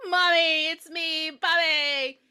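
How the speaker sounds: high singsong announcement; playful little-boy menace; taunting child. Acted. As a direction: sing out your arrival as a creepy kid.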